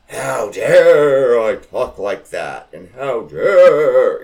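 mock outraged elder. The old cousin glaring at her swearing, mock scandalised. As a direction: play the offended matriarch, scandalised. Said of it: "she gave me the looks".

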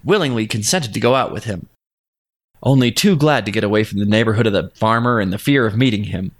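ordinary delivery

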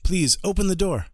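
urgent shout at a shuttered house; calling out. Acted. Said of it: "and he shouts".

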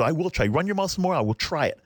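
heated retort, high then dropping at the end; mock yelling back. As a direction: act out an escalating comeback. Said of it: "so now I'm yelling at him".

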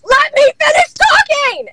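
very high, drawn-out mock shriek; imitated screaming. Boss jumping out of her chair, imitated screaming. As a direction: screech it like a boss out of her seat. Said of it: "screaming her fucking head off".

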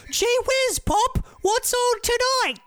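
put-on very high, squeaky gee-whiz kid; over-the-top innocent little boy; wholesome 1950s kid. Voices kid, redoes gee whiz.

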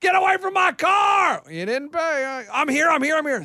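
hammed-up outraged car owner shouting to save his car; angry driver yelling. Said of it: "people yell at 'em all the time".

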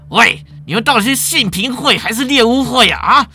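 law department chair. Acted. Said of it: "法律系主任把他压下来，站起来说".

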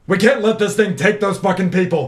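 rally your allies with urgency; acted rallying cry. Repeats line in character, rallying allies.

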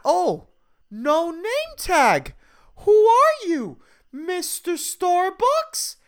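very high, drawn-out mocking singsong; creepy customer voice. Customer taunting barista over name tag, creepy high singsong. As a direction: stretch it out, sickly and childlike. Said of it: "he has a creepy voice".

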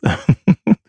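high, airy giggle; bubbly mock giggle. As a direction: break into a giddy laugh. Laughing about spirit realm.

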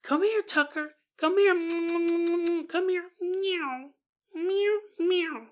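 high sing-song calling, then mewing; calling the cat. Retold or imitated. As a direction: coax the cat to come.

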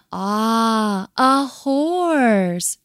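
drawn-out "ah", lifting in pitch; a chorus of dawning realisation, hammed up; murmuring in wonder. A narrator's reading. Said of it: "murmured the Kiwis".

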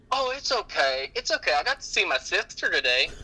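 high and bright as the little daughter; cheery little girl. Retold or imitated. Daughter cheerfully reassuring the cashier, high child voice. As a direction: chirp it like a carefree child. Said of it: "She said".

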